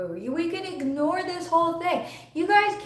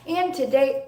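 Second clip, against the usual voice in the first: normal talking voice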